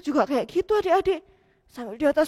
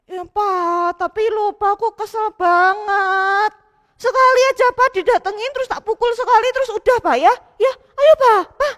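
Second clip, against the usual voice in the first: put-on high, whiny child begging, getting louder; pleading boy; 6 semitones higher, 5 dB louder than usual. Matthew pleading with Papa to let him hit Tori once. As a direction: beg for just one punch.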